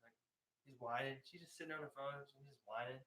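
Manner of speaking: ordinary talk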